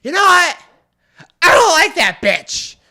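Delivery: high, loud mock yell, dragged out; hammed-up drunken complaint; drunk woman's outburst. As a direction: imitate a drunk friend blurting it loudly. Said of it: "And she's like".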